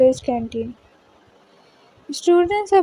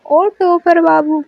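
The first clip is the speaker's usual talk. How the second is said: louder, drawn-out teasing call; slow, raised-voice hail; calling out loudly; 3 semitones higher, 5 dB louder than usual. A narrator's reading. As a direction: call across the canteen to get attention. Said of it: "जोर से कबीर को आवाज़ लगाती है".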